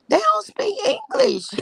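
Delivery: high, laughing near-cry squeal; high cracking-up wail. Real, not acted. Laughing at the phone story.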